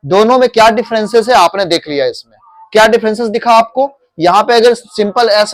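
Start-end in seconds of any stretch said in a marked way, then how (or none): none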